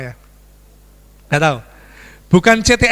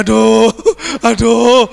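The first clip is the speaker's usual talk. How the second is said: louder drawn aduh; mock moaning in pain; 5 dB louder, much slower than usual. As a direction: groan as you walk out. (mengaduh kesakitan dengan suara keras)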